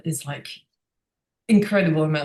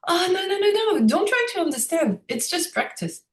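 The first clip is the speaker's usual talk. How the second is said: much higher, gently dismissive monk; mock monk voice; 11 semitones higher than usual. Monk brushing off the guest's question, high and gentle. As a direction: wave away the question in a high, light voice.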